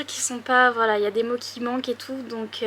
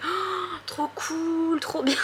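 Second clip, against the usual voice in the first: higher, squealed, excited; gushing delight; 6 semitones higher, much slower than usual. Retold or imitated. Rewatching her footage, squealing with delight. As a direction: gush like discovering it fresh. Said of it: "je suis en mode".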